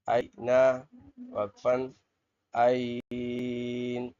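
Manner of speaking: आईन with the long vowel drawn out at the pause; drawn-out आईन when stopping; stretched pause ending. Shown as a demonstration. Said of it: "अब ये खींच कर पढ़ेंगे".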